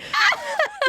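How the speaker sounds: squeaky sobbing whimper; high crying squeal. Shown as a demonstration. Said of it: "this is how I cry".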